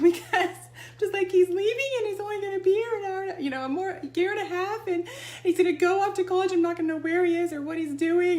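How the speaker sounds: high teary voice, laughing through tears; half-laughing, half-crying rush of worry about a son leaving; retold crying. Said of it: "I started crying".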